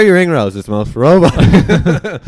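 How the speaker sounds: teasing jab. Real, not acted.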